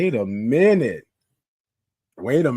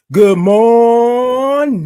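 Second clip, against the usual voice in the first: loud, high, very long-held "morning"; signature-style sustained morning call; drawn-out greeting call; 10 semitones higher, 11 dB louder, much slower than usual. Shown as a demonstration. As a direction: belt the greeting out, stretching it right out. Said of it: "Let's try it this way".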